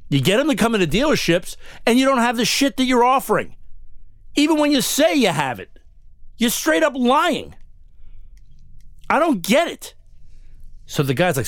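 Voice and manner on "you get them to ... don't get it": high-pitched, exasperated accusation, retold; worked-up indignation. Customer accuses the dealership of lying, worked-up and exasperated. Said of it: "you're getting really excited".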